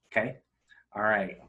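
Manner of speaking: ordinary talk, nothing marked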